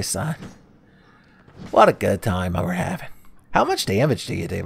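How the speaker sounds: ordinary talk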